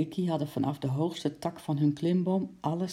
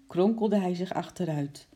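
ordinary delivery, nothing marked

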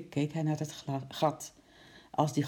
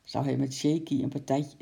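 plain reading voice

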